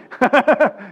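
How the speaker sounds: loud, high burst of laughter; amused laugh. Real, not acted. Preacher laughing about being told he copies Hagin, amused laugh.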